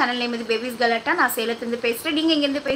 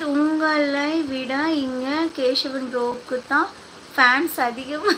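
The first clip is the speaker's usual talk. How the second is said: ordinary delivery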